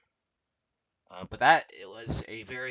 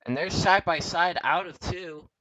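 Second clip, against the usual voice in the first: rising, loud race call; excited commentary; 8 semitones higher, 10 dB louder than usual. Real, not acted. Live race battle, side by side.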